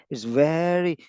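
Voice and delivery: ordinary talk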